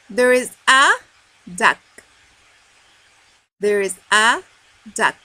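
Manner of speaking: loud, spaced-out reading for repetition; teacher leading children word by word; read-along model. Shown as a demonstration. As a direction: hang on a rising "a", then drop onto "duck". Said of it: "let's read together".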